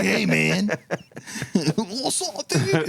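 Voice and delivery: high, laughing surfer dude. Retold or imitated. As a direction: goof on a chill surfer dude. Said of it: "It's a surfer".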